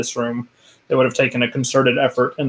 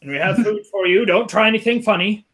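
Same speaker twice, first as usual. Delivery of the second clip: raised, higher-pitched shout of warning; yelled warning, voice pitched up; yelling through a door; 7 semitones higher than usual. Acted. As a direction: call out a wary order to people behind a door. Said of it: "yelling out, uh, saying".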